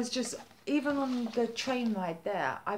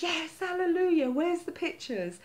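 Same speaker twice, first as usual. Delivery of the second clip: a higher, louder retold burst of joy; excited cheer; 8 semitones higher, 4 dB louder than usual. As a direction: cheer like good news at last. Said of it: "So I'm like".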